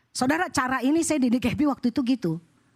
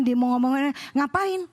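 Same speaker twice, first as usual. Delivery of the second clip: usual speaking voice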